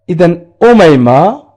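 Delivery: ordinary delivery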